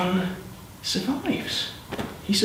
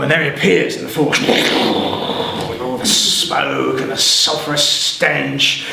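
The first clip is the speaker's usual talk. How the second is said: ordinary delivery, nothing marked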